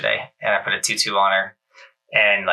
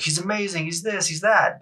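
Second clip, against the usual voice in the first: lightly imitated passers-by, higher and a bit softer; gushing onlookers; 8 semitones higher, 4 dB quieter than usual. Passers-by praising Roman, imitated with a light mock gush. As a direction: toss it off like praise from strangers on the slope. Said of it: "people are gonna ride by and be like".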